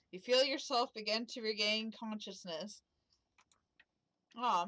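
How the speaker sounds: ordinary delivery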